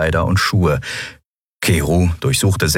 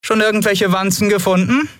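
very high teasing call; high, lightly mocking question. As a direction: call through the door, pitched way up. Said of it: "hörten sie Lien rufen".

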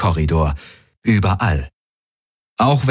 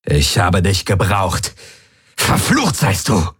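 slow, bitter curse rising in pitch; venomous, drawn-out curse; cursing. A narrator's reading. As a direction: curse them through clenched teeth. Villain curses his victim.

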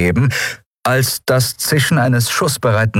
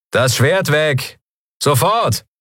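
slow, higher command; commanding pirate; 9 semitones higher, slower than usual. A narrator's reading. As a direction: order her to drop it.

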